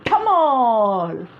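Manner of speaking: spoken bang sound tamal; mock balloon pop. Balloon bursting sound effect.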